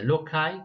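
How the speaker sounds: loci said LOH-kai; "loci" as LOH-kai, rhyming with sky; hard-c pronunciation. Shown as a demonstration. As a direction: say loci with a k sound and an "eye" ending. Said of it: "can be pronounced as".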